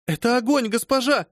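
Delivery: high, loud alarmed cry; frightened cry. Acted. Servant warning her mistress of the fire in fear. Phoebe crying out about fire.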